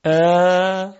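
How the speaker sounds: "ا" as a long, steady, held aa; unobstructed long vowel. Shown as a demonstration. Teacher showing nothing blocks the sound, "ا" sustained. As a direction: hold "ا" open and sustained. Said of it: "صدای آ بدون هیچ مانعی".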